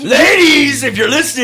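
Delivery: high loud screechy call; raptor mode. Acted. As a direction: shriek it like a raptor. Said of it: "when you go into raptor mode".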